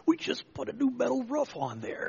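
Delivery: high mock complaint from the church trustees; grumbling trustees. Preacher voicing trustees fretting over their new roof. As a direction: whine it like a fussy trustee.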